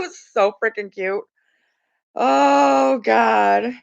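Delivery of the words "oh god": ordinary talk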